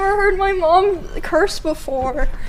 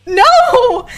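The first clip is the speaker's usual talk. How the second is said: a squeaky, laughing, horrified no, loud and high; 10 semitones higher, 7 dB louder than usual. Retold or imitated. Answering grandma, a high laughing shocked no. Denying grandma's odd question.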